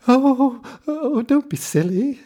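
put-on old woman lying to the giant, high and loud; flustered old woman. A narrator's reading. Old woman covers for Jack.

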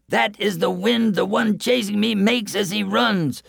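high-pitched boastful runner's voice; boastful man. A narrator's reading. Fleeing braggart warns villagers of his pursuer, high-pitched boaster. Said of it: "said the boastful man".

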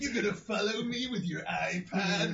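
hammed-up rant at technology; mock rant. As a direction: rail at gadgets, half joking. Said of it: "a minute long rant at technology".

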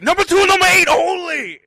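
high, full-volume mock shout; imitated yelling. Drive-thru worker to customers, yelling the only options. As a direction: yell it out like the fed-up order-taker. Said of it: "he fucking yells at us".

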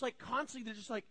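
ordinary delivery

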